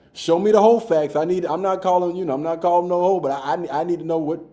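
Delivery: loud, high-pitched insistence; mock demand. Host jokingly demands the facts from viewers, loud mock demand. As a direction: demand it at full volume, playfully.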